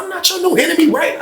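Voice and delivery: retold protest. Protests being called the new enemy.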